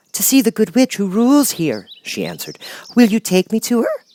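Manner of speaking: put-on high, worried child voice; girl voice. Dorothy asking friends what to do, high worried girl. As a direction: ask anxiously.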